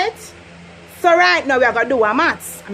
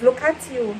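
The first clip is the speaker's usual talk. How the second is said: ordinary talk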